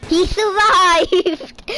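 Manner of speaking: high, loud, stretched-out shout; amazed outburst. Real, not acted. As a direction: crow it slowly in disbelief. Ragdoll survives again.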